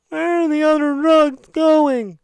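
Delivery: high, loud, slow comic voice; parodying a spoof Batman voice test; silly Batman voice. Retold or imitated. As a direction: ham up a wrong Batman voice. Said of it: "Batman chooses his Bat voice".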